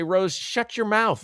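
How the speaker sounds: slow, high-pitched telling-off; mock rebuke. Boston fans tell the host off, mock rebuke.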